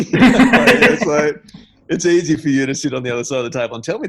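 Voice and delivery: words tumbling out fast through laughter; laughing. Real, not acted. Laughing while recalling the rich man's advice.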